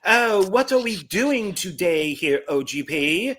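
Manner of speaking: put-on high, lilting costume-drama British accent; period-drama British accent. Co-host imitates the actress's accent for the host, hammed-up period British. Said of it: "she does a very kind of Howard's in the Age of Innocence type of".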